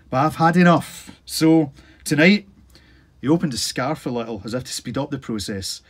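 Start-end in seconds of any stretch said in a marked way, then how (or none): 0.1-2.5 put-on louder and higher, the old man's defiance; old man declares he's had enough, loud and defiant; stubborn outburst. A narrator's reading. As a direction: slam the words down. Old man angrily declaring his wish.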